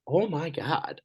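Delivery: high put-on exclamation of disbelief; exasperated. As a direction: throw up your hands, high. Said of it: "And I'm like".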